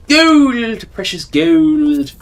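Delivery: high, loud, slow greedy gloat; greedy delight. Pretend. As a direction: savour "Precious gold!" like a miser. Gloating over loot.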